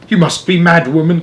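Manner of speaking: put-on father crying out at the old lady; cried out. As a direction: throw it at her in outrage. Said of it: "cried Mister Jenkins".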